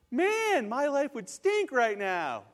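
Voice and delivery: high, loud burst with a slow finish; heartfelt exclamation. Real, not acted. Preacher to congregation on a life without that brochure, exclaiming. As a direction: exclaim it, pitch leaping up then falling. Reacting to imagined missed guidance.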